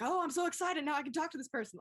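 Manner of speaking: high, bright burst of excited glee; retold nervous thrill; giddy excitement. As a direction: gush like you just got your chance. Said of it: "panic 'cause I'm like".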